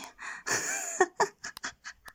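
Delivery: very high, breathy laugh. Acted. Domineering character teasing a partner, high breathy laugh. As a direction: let out a high, airy laugh.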